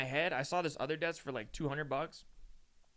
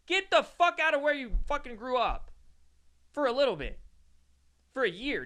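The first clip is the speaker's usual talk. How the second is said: loud, high insistent push; urgent advice; 10 semitones higher, 6 dB louder than usual. Real, not acted. Urging viewers to leave their hometown, a loud high insistence. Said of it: "In all seriousness".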